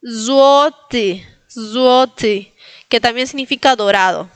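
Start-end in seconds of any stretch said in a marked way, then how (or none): 0.0-2.5 Złoty as long ZWO-te; Polish u-o glide. Shown as a demonstration. Teacher repeating for learners, drawn-out sounds. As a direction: buzz the z of Złoty, then w-o and te. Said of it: "Como una U y luego O y luego T".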